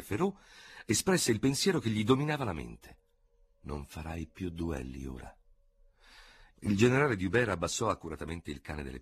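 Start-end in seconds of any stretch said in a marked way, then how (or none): 3.6-5.4 quiet finality. A narrator's reading. Victor's thought after duel.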